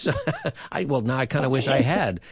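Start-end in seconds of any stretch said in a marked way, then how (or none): 0.2-0.7 high laugh. Real, not acted. Host laughs at caller's story.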